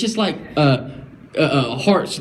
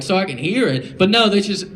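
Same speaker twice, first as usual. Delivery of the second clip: normal talking voice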